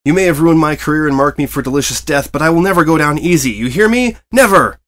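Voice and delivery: loud, climbing to a yelled "Never!"; defiant shout. A narrator's reading. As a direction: vow it with wild defiance. Defiant vow to rabbit.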